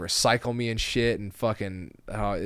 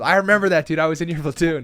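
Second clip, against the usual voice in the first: louder, higher excited greeting; imitated loud hail from an old platoon mate; excited recognition; 8 semitones higher, 12 dB louder than usual. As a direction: act out someone excitedly recognizing an old comrade. People excitedly hailing him, acted.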